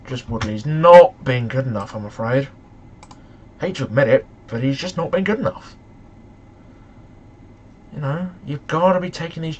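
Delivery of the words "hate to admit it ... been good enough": normal talking voice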